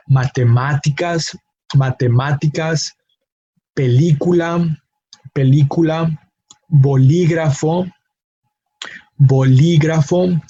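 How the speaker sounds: matemáticas, película, bolígrafo, América, teléfono stressed on the third to last syllable; ma-te-MÁ-ticas, pe-LÍ-cula, bo-LÍ-grafo, a-MÉ-rica, te-LÉ-fono, each said twice. Shown as a demonstration. Teacher demonstrates matemáticas to teléfono, each stressed on the third to last syllable. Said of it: "emphasis falls on the third to last syllable".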